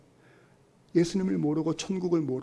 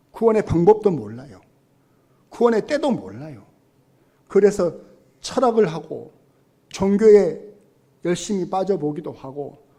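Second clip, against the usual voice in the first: fervent preaching; 3 semitones higher, 7 dB louder than usual. Real, not acted. Preaching climax.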